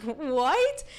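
high-pitched incredulous "what"; retold disbelief. Hearing the family already knew, high mock-stunned reply. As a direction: replay being stunned, pitch jumping up.

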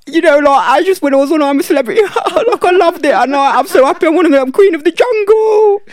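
high, loud, giggly Essex voice. Retold or imitated. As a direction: gush like a giggly Essex celebrity. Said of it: "Can you do a [name] accent?".

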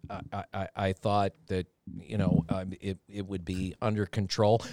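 imitating Carl squirming under the boss, soft stammer; stammering meek excuse. Carl excusing himself to the general manager, soft low stammer.

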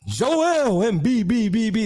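hyped arena call with echo; echoing stadium announcer. Retold or imitated. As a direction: ring it out like a stadium PA. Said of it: "The whole echo be on".

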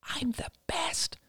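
put-on slow child's whisper; soft, voiceless little boast; whispered back. A narrator's reading. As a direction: whisper it proudly, drawn out. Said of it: "he whispered back".

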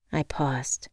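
plain reading voice

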